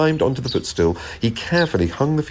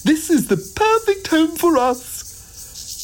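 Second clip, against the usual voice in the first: very high, drawn-out tiny voice; begging insects; much slower than usual. A narrator's reading. Insects wanting the log pile as home, begging. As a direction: squeak it out as a plea. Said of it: "begged the insects".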